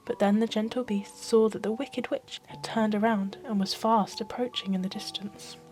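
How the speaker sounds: usual speaking voice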